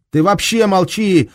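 high, loud squeal; shrieking. Acted. Duska shrieks at the German, high squeal. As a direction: screech at him. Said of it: "взвизгнул на Германа Дуська".

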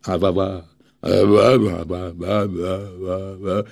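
shaky, stuck on wah wah; trembling stammer. Acted. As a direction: tremble and fail to get words out. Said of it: "трясясь всем телом, силится выговорить".

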